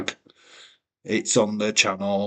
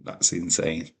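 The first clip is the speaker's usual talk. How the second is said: ordinary talk, nothing marked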